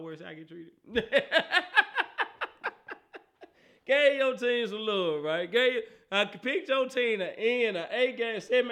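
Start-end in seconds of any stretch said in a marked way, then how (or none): 0.8-3.2 loud high burst of laughter. Real, not acted. Laughs at the treatment. 3.8-7.8 louder, slower, drawn-out complaint; loud protest. Real, not acted.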